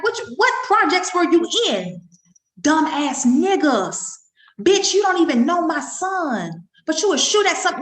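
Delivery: retold furious rant, rising; outraged mother. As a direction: tear into the shooters. Mother yelling at the shooters.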